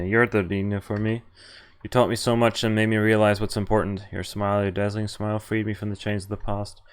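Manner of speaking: ordinary talk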